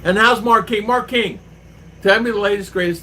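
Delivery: high, loud greeting to a viewer; loud cheerful shout-out to a viewer; hearty shout-out. Real, not acted. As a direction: call out to him warmly and loud. Greeting viewers in chat loudly.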